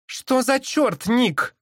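high, drawn-out exclamation; indignant exclamation. A narrator's reading. Anzhi rebukes Nick for the escape, exclaiming. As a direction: exclaim in high-pitched disbelief. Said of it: "воскликнула Анжи".

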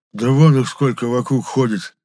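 lightly acted huffy retort; snorting. A narrator's reading. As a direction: snort it dismissively. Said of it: "фыркнул Епифан".

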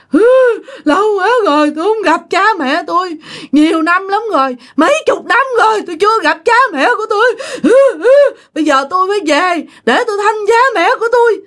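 high, sobbing homesick wail; crying wail. Retold or imitated. Possessed mother crying about parents she hasn't seen. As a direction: sob it out like a lost spirit. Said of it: "khóc hụ hụ hụ mà nói vậy đó".

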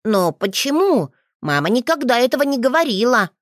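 put-on a little boy amazed at the news; child's voice, sincerely amazed; surprised child. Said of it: "Мальчик искренне изумился".

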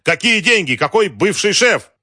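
loud, high outraged questions; indignant shouting. A narrator's reading. General rebuking Wolf for calling, shouting. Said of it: "крикнул генерал".

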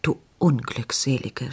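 a hint of a shocked old woman stammering; lightly voiced horrified stammer; dismayed stammer. A narrator's reading. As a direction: falter it out, aghast. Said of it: "stammelte Frau Margit".

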